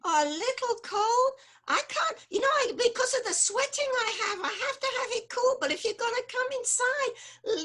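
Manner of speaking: worried Yiddish mama, building to cries; yiddish accent. Acted.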